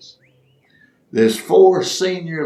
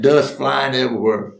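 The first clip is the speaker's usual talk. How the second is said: ordinary delivery, nothing marked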